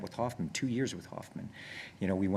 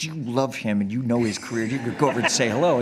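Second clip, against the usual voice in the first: pushy sister; 3 semitones higher, 8 dB louder than usual. Retold or imitated. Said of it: "But she was so pushy".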